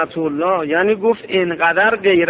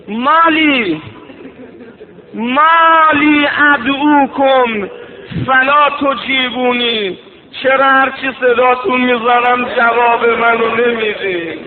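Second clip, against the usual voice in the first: lamenting preaching cry; 4 semitones higher, 3 dB louder than usual. Acted. Imam calling to his fallen companions, mourning cry. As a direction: wail the unanswered call.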